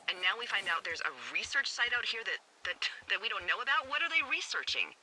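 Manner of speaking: building from uneasy to high-pitched alarm; rising alarm. Acted. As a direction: pitch climbs steeply into an alarmed question. Alarmed at discovering research site.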